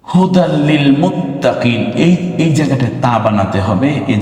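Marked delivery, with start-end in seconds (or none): 0.0-1.8 Quran recitation. Shown as a demonstration. Teacher recites Quranic phrase.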